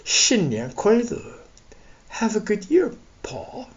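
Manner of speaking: high snake voice trailing to softness; high serpentine voice, softening at the end; hissing snake. A narrator's reading. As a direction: greet slyly as a snake, high and drawn out.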